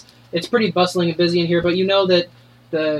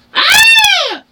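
very high, very loud scream; woman collapsing in the tavern shrieks; piercing shriek; 8 dB louder than usual. Shown as a demonstration. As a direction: shriek to stop the whole bar. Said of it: "this shriek that I will do now".

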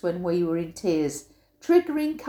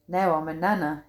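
ordinary delivery, nothing marked

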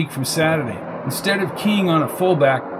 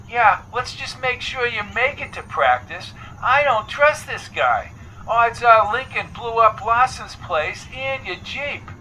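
much higher phone voice; Coco, distrustful and cautioning; 7 semitones higher than usual. A narrator's reading. Coco warns Jones about Lincoln, high cautioning voice. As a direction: caution him in a high caller's voice.

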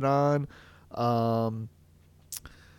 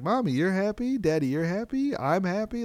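higher, lightly voiced toddler chant; little son's voice; 8 semitones higher than usual. Father imitating his toddler son announcing everyone's happy, in a higher childlike voice. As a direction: echo a cheerful little boy, pitched up. Son Kyle quoted singing happiness.

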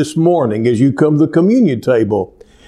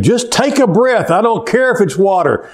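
a hint of desperate lungs, higher; lungs screaming; 7 semitones higher than usual. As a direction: let the lungs plead a little. Lungs voiced as speaking.

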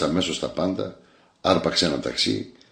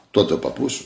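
usual speaking voice

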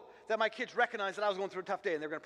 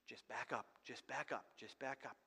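whispered, much softer: just back up; hushed retreat; 12 semitones lower, 18 dB quieter than usual. Retold or imitated. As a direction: sneak away murmuring under breath. Soldiers quietly backing away.

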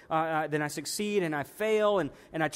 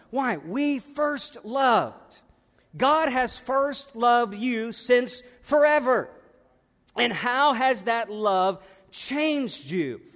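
preaching at full force; 4 semitones higher, slower than usual. Acted. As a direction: stretch We first loved out like a pulpit climax. Preaching climax.

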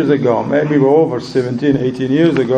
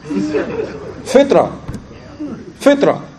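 high-pitched, laughing delight; amused exclamation; 12 semitones higher, much slower than usual. Real, not acted. Delighted by the girl's question.

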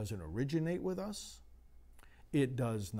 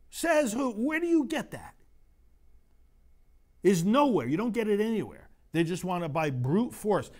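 higher, louder, mounting pulpit indignation; preaching at full force; 8 semitones higher, 7 dB louder than usual. Acted. Priest preaching hard to his congregation, impassioned. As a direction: drive it home to the congregation, rising.

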